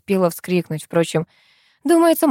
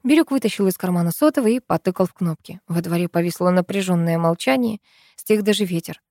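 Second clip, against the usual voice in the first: usual speaking voice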